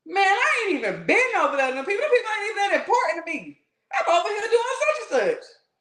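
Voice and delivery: laughing denial. Acted. The devil laughs off the blame he's given, dismissive and amused. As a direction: brush it off with a laugh, fast.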